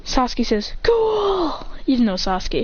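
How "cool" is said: high-pitched impressed exclamation; mock admiring cry; acted awe. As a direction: exclaim like an amazed fanboy.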